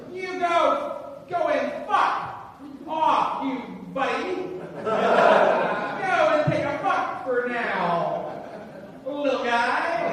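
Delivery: loud, high, drawn-out barker patter; 1930s carnival barker. Acted. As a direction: stretch it out singsong like an old-time barker. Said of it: "sounded more like a nineteen thirties carnival barker".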